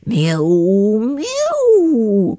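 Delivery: high, loud mewing cat; cat crying. A narrator's reading. Simpkin crying at the shop door, loud high mew. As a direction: mew loudly like a hungry cat. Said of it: "cried Simpkin".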